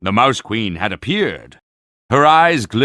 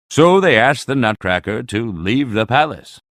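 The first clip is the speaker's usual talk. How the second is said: usual speaking voice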